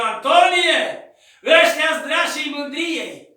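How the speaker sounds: chemare tare, mai sus, apoi rar; vorbește ca o voce de sus; glasul lui Dumnezeu. Retold or imitated. (glas dumnezeiesc tare și rar)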